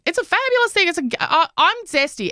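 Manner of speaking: high camp flourish; camp declaration. Retold or imitated. Mother getting camper while arguing with her kids, high. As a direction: say it fabulously camp. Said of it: "I was getting camper and camper".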